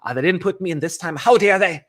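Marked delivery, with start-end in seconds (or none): 1.2-1.8 mock outrage. Mock outrage at being left out.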